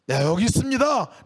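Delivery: calling out. Acted. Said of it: "라고 얘기하는 것".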